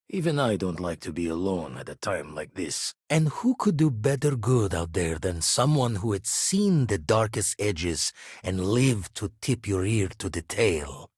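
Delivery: ordinary delivery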